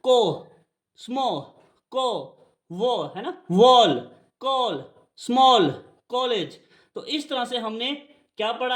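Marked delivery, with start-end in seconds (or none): 0.0-3.0 "को, स्मॉ, को, वो" ending on the o, final l left off; word by word, stop on the vowel in "को, स्मॉ, को, वो"; isolated o sound. Shown as a demonstration. Teacher isolating the ओ, "को, स्मॉ, को, वो" without final l. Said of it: "ओ की sound दे रहा है". 3.5-6.6 "वॉल, कॉल, स्मॉल, कॉलेज" with rounded aw; o sound. Shown as a demonstration. Teacher drilling the ओ rule, rounded o in "वॉल, कॉल, स्मॉल, कॉलेज". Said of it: "ओ की sound दे रहा है".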